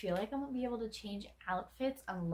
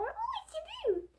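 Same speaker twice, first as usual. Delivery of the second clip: very high squealed pet voice; tiny, sky-high cat-talk voice. Acted. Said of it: "to, like, any cat".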